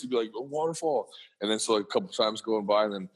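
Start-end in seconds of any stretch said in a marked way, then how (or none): 0.4-1.1 lightly imitated small boy, higher and softer; soft, swooping imitation of a little kid; cute little deep voice. Dad imitating his three-year-old son, cute little kid voice. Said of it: "cute little deep voice".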